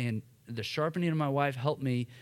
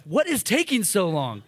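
higher, louder angry demand; yelling at wife; 6 semitones higher, 6 dB louder than usual. Retold or imitated. Husband storming into the pharmacy at his wife, angry yell. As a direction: snap at her in front of the clerk. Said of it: "I'm yelling at my wife".

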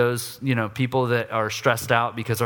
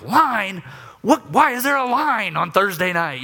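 loud, higher, disbelieving snap; incredulous outburst; 6 semitones higher, 3 dB louder than usual. Retold or imitated. Annoyed dad in the store line, loud and higher. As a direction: bark it out like an angry lion. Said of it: "I'm just, like, a lion".

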